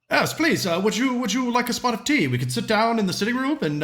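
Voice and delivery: an overly polite gentleman offering tea; hammed-up gracious host.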